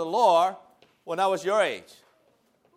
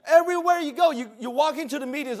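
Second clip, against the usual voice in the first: normal talking voice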